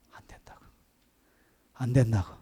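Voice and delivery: urgent whisper, no voice; whispered warning. Retold or imitated. Wife warning her husband over the phone, whispered. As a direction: breathe it out in alarm.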